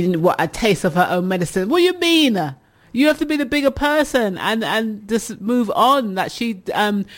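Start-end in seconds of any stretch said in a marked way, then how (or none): 1.7-6.1 incredulous. Real, not acted. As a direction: push back in disbelief. Host challenges listener's advice.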